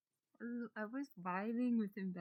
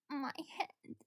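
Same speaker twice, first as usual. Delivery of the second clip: higher, woozy character voice; acted dizziness; 5 semitones higher, 4 dB quieter than usual. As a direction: play dizzy and disoriented, pitch raised. Voicing a character waking up dizzy.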